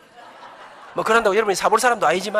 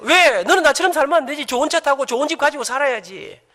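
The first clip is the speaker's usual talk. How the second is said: higher, louder retort to the son; loud fatherly reply; 5 semitones higher, 7 dB louder than usual. Retold or imitated. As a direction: tell the son off loudly with a grin. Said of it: "제가 그랬어요". (아들에게 높고 크게 되받아치는 아버지)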